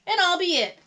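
high, loud eager offer; volunteer eagerly; exclaiming. A narrator's reading. Molly offers to be the seeker, exclaiming. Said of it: "exclaimed Molly".

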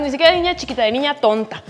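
usual speaking voice

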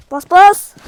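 sudden loud yell to press the button; urgent gamer shout; shouting urgently. Real, not acted. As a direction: bark it out at your teammate. Urging teammate in game.

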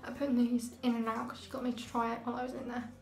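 slight lisp from aligners; speaking with clear braces; retainers in mouth. Real, not acted. Seen 0: Fitted before at -5 to -4, removed later; clear aligners barely visible.

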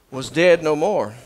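ordinary delivery

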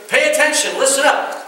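put-on playful scold to the kids; imitating a parent. Parent telling the kids to listen up, mock scolding. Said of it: "You ever say that to your kids?".

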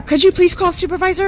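high, loud insistent plea; pleading demand. Real, not acted. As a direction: push the request up high. Arrestee demands officer's supervisor.

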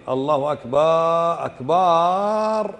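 "أكبر" drawn out to "akbaaaar"; muezzins' mistake. Shown as a demonstration. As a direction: stretch the final vowel of "أكبر" and hold it like a muezzin. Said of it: "خاصة المؤذنين".

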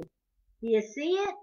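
ordinary delivery, nothing marked

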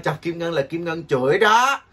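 laughing through a playful warning. Real, not acted. Host laughing at teasing viewers, warning them. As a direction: chuckle as you tease the viewers.

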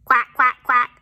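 loud quacking ducklings; quack-quack like little ducklings. Acted. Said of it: "say the ducklings".

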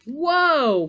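loud, high, drawn-out cry; startled cry. A narrator's reading. Santa is jerked along by the reindeer, startled cry. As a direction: cry out in alarm. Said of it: "cried Santa".

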